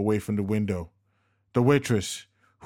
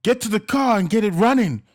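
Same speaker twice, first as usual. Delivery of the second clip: high, loud urgent command; shouted order; 11 semitones higher, 7 dB louder than usual. A narrator's reading. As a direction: shout her toward the car. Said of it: "Casper shouted".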